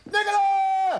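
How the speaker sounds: very high, loud holler; defiant shout. Acted. Husband ignores wife's scolding to call servant, shouting. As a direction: bellow the name, long and high. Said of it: "Don't shout".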